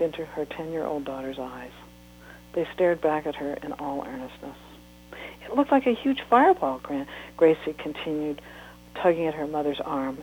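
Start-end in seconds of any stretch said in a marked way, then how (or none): 5.4-6.8 excited. Acted. Child pleading excitedly.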